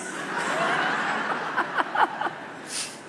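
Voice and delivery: high airy laugh; preacher laughing at his quip; breathy laugh. Real, not acted. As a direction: laugh at your own joke. Laughs after his joke.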